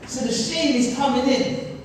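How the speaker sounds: normal talking voice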